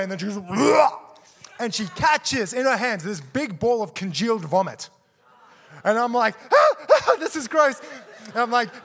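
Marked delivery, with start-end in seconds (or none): 0.5-0.9 one big, loud mock heave; higher, explosive pretend retch; imitated vomiting. Preacher acting out girl throwing up, loud mimed vomit noise. 6.5-7.8 high, loud mock yelps of revulsion; acted disgusted squeals. Preacher retelling being faced with vomit, high squeals of disgust. Acts out his disgusted reaction.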